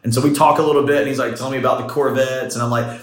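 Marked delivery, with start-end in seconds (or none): none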